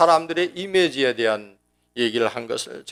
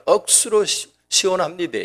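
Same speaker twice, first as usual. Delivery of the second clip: Gyeongsang dialect; 4 semitones higher than usual. Retold or imitated. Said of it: "경상도는".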